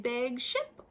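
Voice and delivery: ordinary delivery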